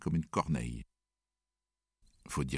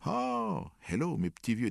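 cheery greeting; 9 semitones higher than usual. A narrator's reading. Toby cheerfully greeting friends.

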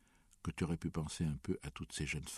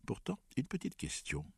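female voice; 10 semitones higher than usual. Acted. Griselda continues.

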